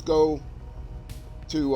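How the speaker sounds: normal talking voice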